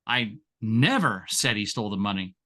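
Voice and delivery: never hit hardest and held; never stressed over the rest. Shown as a demonstration. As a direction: punch the word never. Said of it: "Say the same exact thing six different ways".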